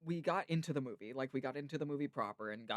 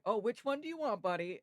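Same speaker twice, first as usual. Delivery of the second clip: higher-pitched, imitated store owner to a child; friendly shop owner; 9 semitones higher, 4 dB louder than usual. Shop owner helping a small regular customer, friendly imitation. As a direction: lift the pitch like addressing a little kid. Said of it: "come over and be like".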